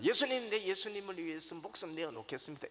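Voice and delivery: high, loud, fast pledge; fervent vow. Pretend. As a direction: swear it with all your heart. Peter's expected fervent vow.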